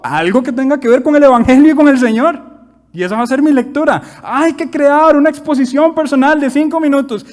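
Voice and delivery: loud, high-pitched sermon delivery; fervent preaching. Acted. Preacher tells congregation of his faith, loud fervent preaching. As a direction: rouse the church with every phrase.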